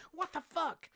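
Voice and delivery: a high, startled exclamation. Retold or imitated. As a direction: jump at the swarm, high and sudden. Startled by cicadas at 8 am.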